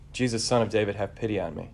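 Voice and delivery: usual speaking voice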